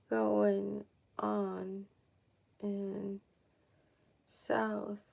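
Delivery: very soft, slow, every word apart with long pauses; hushed slow asking. Shown as a demonstration. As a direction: ask it quietly as if listening for an answer. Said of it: "If you are dead serious, let's see".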